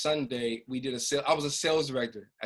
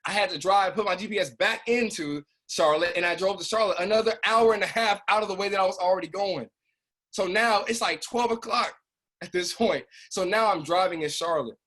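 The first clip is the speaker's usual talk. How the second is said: higher and louder, worked up; reliving the wrong-way drive, pitched up; agitated storytelling; 7 semitones higher, 5 dB louder than usual. Retold or imitated. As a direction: tell it like it still stings. Agitated retelling, high and loud.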